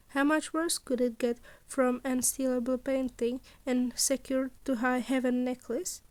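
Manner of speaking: ordinary delivery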